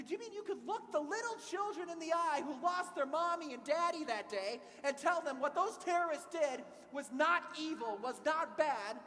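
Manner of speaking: put-on confronting the young man about 9/11, loud and heated; heated confrontation. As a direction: hammer the question home, loud and high. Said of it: "things are really getting heated".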